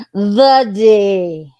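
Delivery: loud, hammed-up exclamation; mock outcry. Real, not acted.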